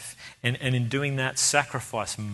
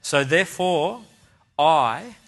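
higher, louder, assured statement; confident declaration; 4 semitones higher, 7 dB louder, slower than usual. Acted. Believer standing back to declare their hope, confidently. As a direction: declare it firmly, with weight on each phrase. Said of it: "stand back and say confidently".

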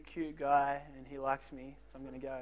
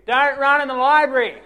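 high, loud scolding call; hammed-up shushing librarian shout; librarian yelling; 8 semitones higher, 15 dB louder than usual. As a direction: yell after the runner like a stern librarian. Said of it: "the librarians are yelling after you".

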